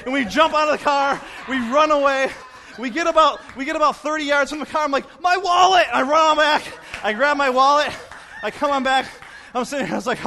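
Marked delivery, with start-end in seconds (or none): 5.2-5.9 high, loud sudden exclamation; alarmed cry. Retold or imitated. Fleeing driver remembering what he left, alarmed high yell.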